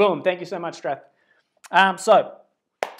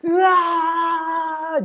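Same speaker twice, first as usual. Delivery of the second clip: loud high anguished cry; 12 semitones higher, 7 dB louder than usual. Real, not acted. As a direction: let out a pained yell. Listing his violent past.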